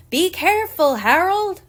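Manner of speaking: loud, high warning shout; mom's scream. A narrator's reading. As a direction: yell a sudden warning up at the ladder. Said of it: "I heard my mom scream".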